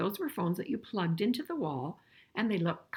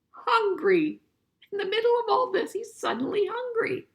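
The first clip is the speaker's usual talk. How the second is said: surprised; 13 semitones higher, 7 dB louder than usual. Real, not acted. Teacher reacts to picture.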